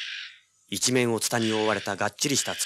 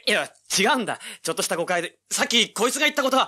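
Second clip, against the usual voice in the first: high, loud frantic protest; man begging the armed villagers to listen; desperate denial; 11 semitones higher, 5 dB louder, faster than usual. Acted. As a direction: plead for your life.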